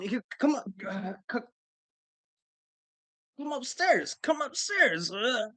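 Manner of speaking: high, frantic, stammering panic voice; Bobcat Goldthwait. Retold or imitated. Pat panicking at the door, high frantic stammering. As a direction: sputter it out like a nervous wreck. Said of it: "get all Bobcat Goldthwait on me".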